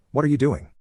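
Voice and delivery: alarmed mother yelling, lightly imitated; mock yell. Mother jumps up at her son dialing, mild mock yell.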